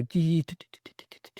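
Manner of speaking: imitating an old rotary phone dialing; rotary dial clicks. Telling how old rotary phones dialed, imitating the beeps. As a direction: tap out the dial noise as quick beeps. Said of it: "the ones where you'd go".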